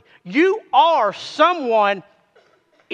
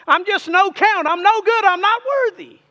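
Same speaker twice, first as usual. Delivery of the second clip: higher, mocking voice of the flesh; a put-upon self-pitying whine, much higher; whiny flesh voice; 7 semitones higher, 3 dB louder than usual. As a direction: pitch it up to mock the self-pity. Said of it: "They say".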